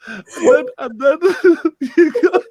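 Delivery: helpless laughing. Real, not acted. Telling Dino the story, crying with laughter. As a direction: try to go on but keep dissolving. Laughing too hard to continue.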